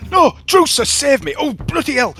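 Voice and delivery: high Yorkshire yelps of terror; Yorkshire lad pleading with his brother to drop the knife; Yorkshire accent, high and terrified; panicked Yorkshire accent. Acted. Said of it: "with a Yorkshire accent".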